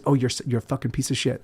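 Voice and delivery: low, soft inner voice of contempt; self-loathing mutter. Retold or imitated. Waking up, he voices his inner critic quietly. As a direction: sneer it under your breath. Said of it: "the very first thing I think is".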